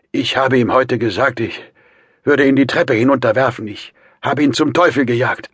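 high, loud, agitated boast; angry defiance. A narrator's reading. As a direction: throw it at them defiantly. Brother reports throwing suitor out.

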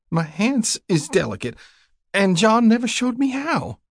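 high-pitched, plaintive country woman; helpless young widow. A narrator's reading. Young widow explains to rider why she can't drive, plaintive. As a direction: make excuses in a high, helpless voice.

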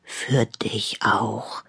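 slow, low near-whisper; hushed, gentle return wish; soft reply. A narrator's reading. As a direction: answer quietly and slowly. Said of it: "sagte Leo leise".

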